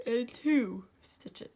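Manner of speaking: slow yawn through the words. Real, not acted. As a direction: say it mid-yawn. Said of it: "sorry about my yawning".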